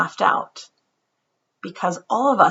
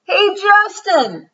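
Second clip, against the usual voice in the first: high, slow call across the playground; 12 semitones higher, 7 dB louder, much slower than usual. Acted. Emilio calling to Justin from the tetherball court, loud and high. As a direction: stretch it out to reach him.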